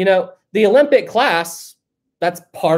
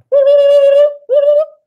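long, loud, high gobbling; turkey gobble; 9 dB louder than usual. Shown as a demonstration. Streamer testing the turkey sound on live chat, gobbling. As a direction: show off a turkey impression. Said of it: "how's my turkey sound?".

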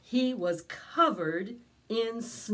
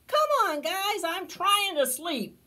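higher, louder exasperated bear; whiny grumpy complaint; annoyed bear; 11 semitones higher, 5 dB louder than usual. Acted. Bear complains to his noisy friends, higher and louder.

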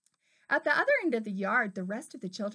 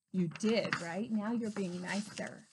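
ordinary delivery

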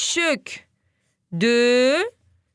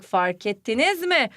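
usual speaking voice

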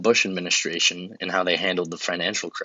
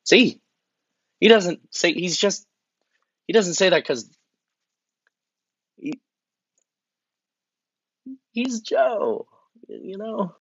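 much higher, scrambling justification; flustered defense; 11 semitones higher, slower than usual. Acted. Defending Biden's gaffe, rising flustered and pleading. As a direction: squeak it out, grasping for an excuse.

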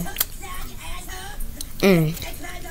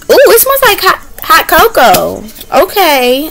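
high, delighted ooh; 8 semitones higher, 4 dB louder than usual. Real, not acted. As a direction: gush over the smell. Smelling the treat.

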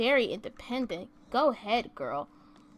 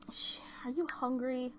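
soft unvoiced whisper, barely audible; 8 dB quieter than usual. Real, not acted. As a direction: ask it in a hushed whisper. Whispering a question aloud.

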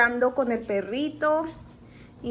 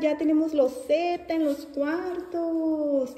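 usual speaking voice

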